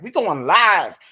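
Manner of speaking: loud, slow hammed-up live call; mock loud announcement. Imitating the date filming her at the buffet, loud and slow. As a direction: play up his camera hype.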